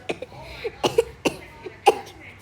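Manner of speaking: a real breathy coughing fit. Real, not acted. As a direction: cough hard into the mic. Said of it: "I got a cough".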